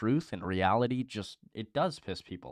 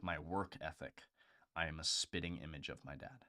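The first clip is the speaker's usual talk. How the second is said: ordinary talk, nothing marked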